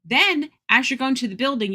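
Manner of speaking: ordinary talk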